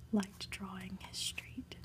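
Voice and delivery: plain reading voice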